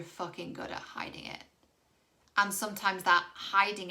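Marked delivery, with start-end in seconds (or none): 0.0-1.4 a quick confiding whisper; whispered aside to the viewer. Real, not acted. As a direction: let it drop to a whisper, just between us.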